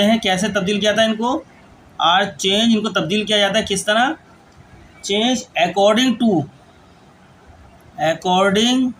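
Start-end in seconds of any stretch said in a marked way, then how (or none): 5.0-8.9 word-by-word dictation with long pauses; slow dictation. Acted. Teacher dictating a grammar rule to students, slow dictation. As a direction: dictate slowly, giving time to write.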